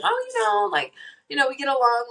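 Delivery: high, mock-cheery voice of a frenemy; mock fake-friendly. Joking with friends, mock-cheery high voice. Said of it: "be funny and be like".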